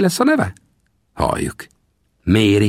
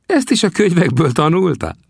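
put-on high teasing old voice; laughing old trapper; 11 semitones higher than usual. Sam laughingly mocks the narrator's book learning, high voice. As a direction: tease the greenhorn, high. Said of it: "Nevetett Sam".

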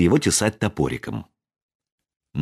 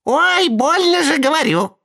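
squeaky wail. A narrator's reading. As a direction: howl it in a tiny voice. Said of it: "завыл тоненький голосок".

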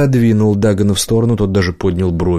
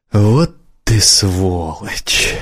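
very slow, drawn-out insult; slow-dawning realisation of being had; stunned reproach; much slower than usual. A narrator's reading. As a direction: stretch each word out in disbelief. Friend discovers he was tricked.

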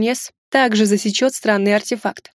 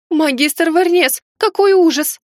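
high-pitched, drawn-out cry of horror; exclaiming; 7 semitones higher, 4 dB louder, slower than usual. Acted. Heroine to the injured magister, exclaiming in horror. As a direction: exclaim in alarm, voice raised high. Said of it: "воскликнула я".

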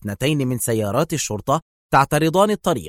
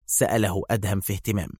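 ordinary delivery

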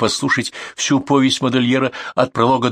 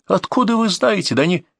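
outraged, pitch climbing high; high-pitched indignant protest; indignant exclamation; 8 semitones higher than usual. A narrator's reading. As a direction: throw the words back in disbelief. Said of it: "воскликнул я во весь голос".

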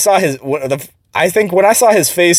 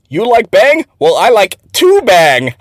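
higher, slow mock broken English, leaning on "two"; deadpan slow accent imitation; 7 semitones higher, 4 dB louder than usual. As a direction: drawl out the boss's accented punchline. Quoting boss's accented joke.

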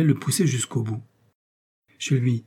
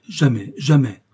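louder, utterly certain denial; emphatic denial; 5 dB louder, slower than usual. A narrator's reading. Milady convincing her rival, emphatic denial. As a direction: swear it, leaving no doubt. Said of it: "un accent qui n'admettait pas le doute".

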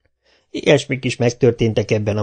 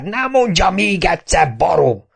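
high, furious repeat of "nem mondja még egyszer"; mayor losing his temper at Kapa; angry outburst; 8 semitones higher, 3 dB louder than usual. A narrator's reading. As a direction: explode on "mondja" the second time.

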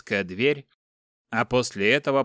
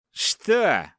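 higher, cheeky challenge; insolent; 7 semitones higher, 4 dB louder than usual. A narrator's reading. Igor defies the captor with a question, insolent. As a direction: throw it back with insolence. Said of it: "дерзко спросил Игорь".

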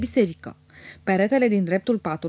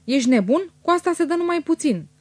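high, scolding outcry at moderate volume; mild shriek of protest; 8 semitones higher than usual. A narrator's reading. Lady scolding her cousin over wasted perfume, mildly shrieking. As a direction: protest in a raised pitch, held short of yelling. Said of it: "țipă doamna".

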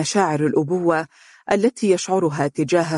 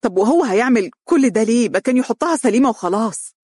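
higher, louder, fed-up complaint; exasperated; 6 semitones higher, 3 dB louder than usual. A narrator's reading. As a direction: huff out the frustration. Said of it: "تأفف عمرو بضيق وزفر". (بضيق وتأفف)

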